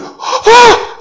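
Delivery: high, hammed-up gasping wail; mock shocked cry. Preacher mimics small girl hurt by his retort, mock high cry. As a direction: imitate a child's sudden high cry. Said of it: "she ran crying".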